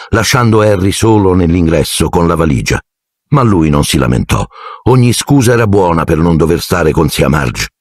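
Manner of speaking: usual speaking voice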